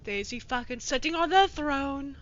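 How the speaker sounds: ordinary delivery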